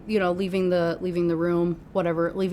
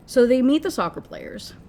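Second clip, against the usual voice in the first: usual speaking voice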